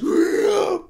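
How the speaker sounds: high, loud roaring rah rahs like a cartoon dragon; mock dragon roar. Reviewer mocking the dragon's roar, loud cartoon roar. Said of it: "the dragon goes".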